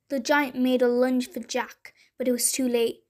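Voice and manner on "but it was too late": usual speaking voice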